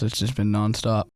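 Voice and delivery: ordinary talk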